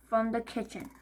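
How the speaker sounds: ordinary delivery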